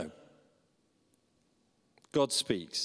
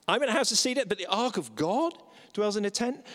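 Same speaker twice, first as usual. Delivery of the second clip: higher, lifting on "in", "God" and "tent"; raised, rising repeat; 6 semitones higher, faster than usual. Shown as a demonstration. Preacher restating David's complaint to the congregation, pitched high. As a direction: say it again up high with rising ends. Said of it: "I'm gonna just emphasize the words".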